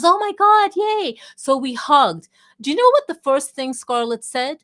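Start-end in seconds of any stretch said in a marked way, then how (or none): 0.0-1.2 much higher, loud, thrilled cry of recognition; overjoyed reunion squeal; delighted squeal. Retold or imitated. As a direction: squeal it like spotting an old friend. Scarlett recognizing her with joy.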